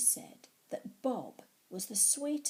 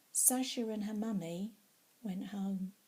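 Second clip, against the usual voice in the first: usual speaking voice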